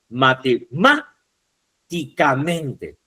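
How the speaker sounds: drawn-out syllables in "matemáticamente", stressed on MÁ. Shown as a demonstration. Teacher shows students the stressed syllable of matemáticamente, syllable by syllable. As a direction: break "matemáticamente" slowly into its syllables. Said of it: "Deben silabear".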